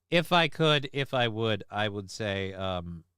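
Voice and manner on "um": usual speaking voice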